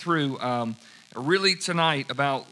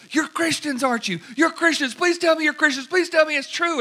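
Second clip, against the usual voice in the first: put-on much higher, pleading girl voice; imitating the girl pleading with the missionaries; eager girl's voice; 12 semitones higher, 4 dB louder than usual. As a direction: beg them to admit it, pitched way up.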